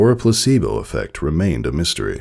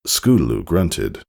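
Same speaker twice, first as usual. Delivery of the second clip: ordinary delivery, nothing marked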